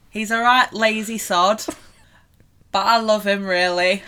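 put-on playful northern English accent; broad Lancashire-style northern accent. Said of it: "Your northern accent is getting well good".

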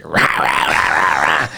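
low, growled mock singer noise; low mock vocal rumble; imitating vocalists. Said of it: "the vocalists are like".

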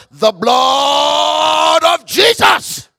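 slow, drawn-out loud shout; retold shout. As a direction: call out the name, stretched long and loud. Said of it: "we're just going to shout".

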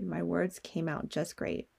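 usual speaking voice

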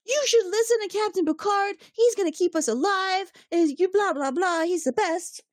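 hammed-up adoring teen defending his hero; squeaky mock rant for the captain; mock yelling fanboy. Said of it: "starts yelling at him".

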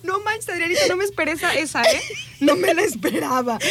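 laughing. Real, not acted. Laughing reaction.

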